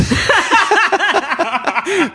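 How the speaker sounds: squealing laugh; high burst of laughter. Real, not acted. Retelling the worm story, she bursts into high laughter. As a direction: let a high giggle burst out. Laughing at her sister's joke.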